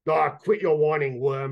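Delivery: gruff ratlike guard snapping at his hungry mate; rough kobold guard. A narrator's reading. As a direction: sneer down at the whiner. Kobold guard scolds partner.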